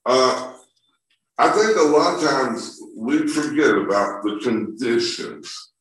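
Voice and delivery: normal talking voice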